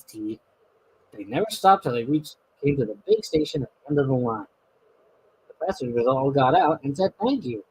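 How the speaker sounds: steady storybook reading voice; story narration. A narrator's reading. Narrator reading the railway story aloud, steady storybook voice. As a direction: tell the tale to a child.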